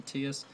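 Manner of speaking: Teus said TEE-us; own pronunciation. Shown as a demonstration. Host tells viewers what he calls it, Teus said TEE-us. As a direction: say Teus as "TEE-us", two syllables. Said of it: "I call him".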